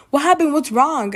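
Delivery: high, loud demanding what happened; retold yelling. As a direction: confront her with a sharp shout. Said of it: "she yelled at her".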